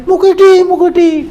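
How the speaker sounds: loud high repeated call; calling again. Acted. Man calling down the well again, loud and high. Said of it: "baleni meneh".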